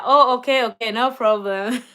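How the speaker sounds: usual speaking voice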